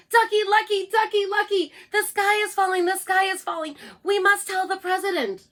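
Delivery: high, loud panicky clucking; clucking hen voice. Acted. Chicken Licken warns Ducky Lucky, high clucking cry. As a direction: cry the alarm like a frantic hen.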